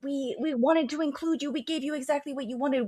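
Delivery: high, put-on possessed voice; high, insistent mock accusation; possessed mouthpiece. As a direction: voice someone channeling a wounded clique. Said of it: "like he's possessed, starts voicing everything".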